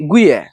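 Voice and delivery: গুইয়া said in Manikganj dialect; dialect word. Shown as a demonstration. Villager showing viewers the local word for betel nut. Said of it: "আঞ্চলিক ভাষায় এটাকে বলে".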